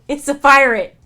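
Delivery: loud, high and pleased; shouted pirate reveal; triumphant reveal. Real, not acted. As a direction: announce it like a solved mystery. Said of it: "We've solved the mystery".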